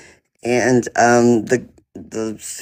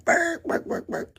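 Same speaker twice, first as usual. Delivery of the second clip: high squeaky yips trailing to breathless; mock dog barks; 9 dB quieter than usual. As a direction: toss off little yappy dog barks, fading. Imitating dogs barking.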